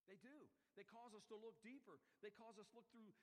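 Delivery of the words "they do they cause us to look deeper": ordinary talk, nothing marked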